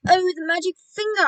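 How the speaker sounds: high, loud exclamation; alarmed cry. A narrator's reading. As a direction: cry out in sudden dismay. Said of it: "I cried".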